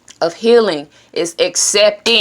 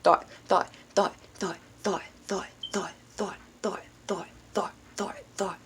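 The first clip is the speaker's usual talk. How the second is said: fading repeated thoughts; 15 dB quieter than usual. Shown as a demonstration. Vlogger imitates racing thoughts, fading to whisper.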